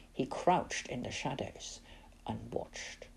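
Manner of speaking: whispered, tense storytelling; hushed narration. A narrator's reading. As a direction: drop to a secret hush. Narrator hushes for hiding scene.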